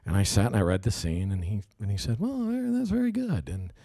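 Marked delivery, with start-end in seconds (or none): 2.2-3.5 lightly imitating director's mild praise; a gentle, slightly quirky pat on the back; funny manner. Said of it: "he's got such a funny manner".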